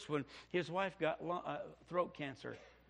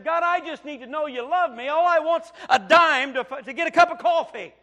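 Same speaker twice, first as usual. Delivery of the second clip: crying out to God; 11 semitones higher, 17 dB louder than usual. Retold or imitated. Young student crying out to God for a dime, loud and high. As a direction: beg God with everything.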